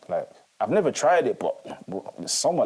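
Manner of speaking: ordinary delivery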